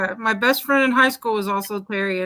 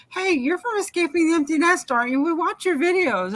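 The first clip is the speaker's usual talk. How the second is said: eager fan; 8 semitones higher than usual. Retold or imitated. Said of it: "they walk up and they say".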